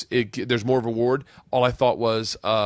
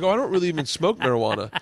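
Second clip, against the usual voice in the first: quick, slightly higher denial; fast flustered reply; 5 semitones higher, faster than usual. Retold or imitated. Refusing the guest's joint, fast and flustered. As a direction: hurry it, a bit embarrassed. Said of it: "I was like".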